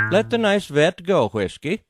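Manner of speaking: a hint of a Scandinavian au pair scolding the dog; Scandinavian accent. Au pair telling Whiskey to release the vet, light Scandinavian accent. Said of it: "she said in a Scandinavian accent".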